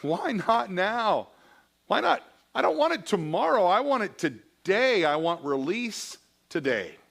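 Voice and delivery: Augustine pleading with God for release today. Acted. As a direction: beg God for release now. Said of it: "he's pleading with God".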